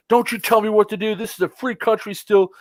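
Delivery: retold angry yelling. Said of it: "the guy starts yelling at me".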